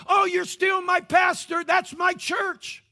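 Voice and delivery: lightly voiced old member claiming him; fond old member. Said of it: "people we'll talk to".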